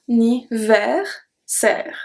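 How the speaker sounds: Anniversaire very slowly, with open è at the end; slow Anniversaire, open è sound; slow model word. Shown as a demonstration. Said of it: "make the same sound as the E".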